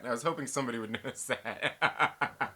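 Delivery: breaking into laughter mid-line; laughing through it. Real, not acted. Streamer repeats his admission to chat, laughing. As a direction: let the laugh take over the words.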